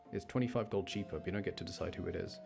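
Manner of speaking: normal talking voice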